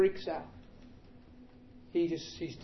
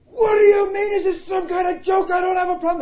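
high, loud disbelieving shout; vehement shouting; 14 semitones higher, 14 dB louder than usual. Retold or imitated. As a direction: bellow it in outraged disbelief. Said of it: "He's vehement".

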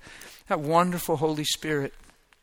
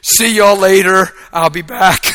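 louder, slowly drawn out, overcome; overwhelmed loud exclaim; 5 semitones higher, 14 dB louder, slower than usual. Real, not acted. Preacher tells church how mercy undid him, loud and slow. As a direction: belt it out like he's undone. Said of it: "I was done".

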